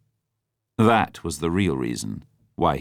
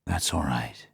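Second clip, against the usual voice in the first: soft and lower, soothing; calm her down gently; comforting; 4 semitones lower, 7 dB quieter than usual. A narrator's reading. Bond comforting crying Vesper, soft and low. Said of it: "said Bond comfortingly".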